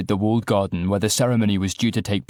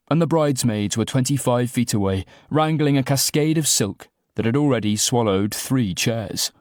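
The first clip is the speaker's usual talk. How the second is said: usual speaking voice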